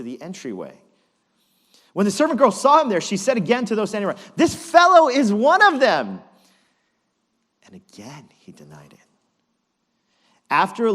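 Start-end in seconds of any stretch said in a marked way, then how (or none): 1.9-6.2 fast, higher and louder telling; building accusation. Acted. Rising accusation in the story. 7.6-9.0 unvoiced hushed whisper. Hushed after loud accusation.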